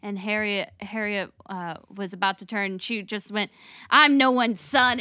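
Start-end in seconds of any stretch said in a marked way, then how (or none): 3.9-5.0 louder, slow defiant line; defiant declaration. Retold or imitated. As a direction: lay it down slowly and proudly. Harriet unmasking herself.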